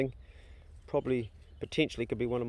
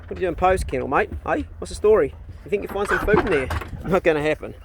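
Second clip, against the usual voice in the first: loud, higher-pitched talk to a dog; teasing challenge; 6 semitones higher, 7 dB louder than usual. Real, not acted. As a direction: call out a playful challenge to the dog. Challenging dog nosing in kennel.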